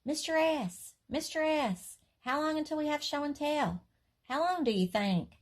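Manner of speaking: put-on high eager little girl calling the teacher; child calling out. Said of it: "I stood up and shouted".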